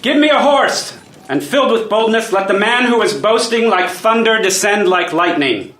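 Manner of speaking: rallying battle cry. Acted. Duke must earn Poland's allegiance, calls for a horse, rallying. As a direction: charge off to prove yourself.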